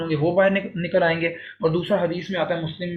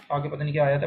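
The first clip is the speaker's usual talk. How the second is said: ordinary talk, nothing marked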